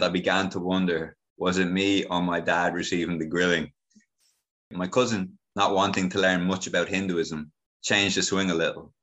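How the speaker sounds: normal talking voice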